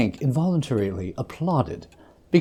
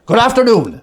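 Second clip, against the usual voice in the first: high, loud final brush-off; loud dismissal; 9 semitones higher, 8 dB louder than usual. A narrator's reading. Scrooge barking his nephew out, loud. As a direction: slam the door with words. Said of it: "said Scrooge".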